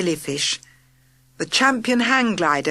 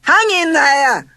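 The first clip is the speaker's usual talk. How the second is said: loud, high, slow call; encouraging shout; 8 semitones higher, 8 dB louder, slower than usual. A narrator's reading. Charlie shouting to the family from above, encouraging. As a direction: call out to steady them. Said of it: "shouted Charlie".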